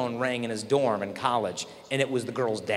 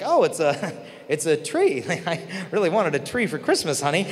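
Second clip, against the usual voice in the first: imitating polite disappointment, laughing through it; higher, halting mock thanks with chuckles; feigned delight; 3 semitones higher, 3 dB louder than usual. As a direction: pretend you love an underwhelming present. Girl acts pleased at tree gift.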